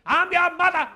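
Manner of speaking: put-on high, loud, slow defiant old mom; stubborn old mother. Elderly mother refusing the booster seat, high loud defiance.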